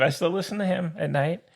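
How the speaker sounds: ordinary delivery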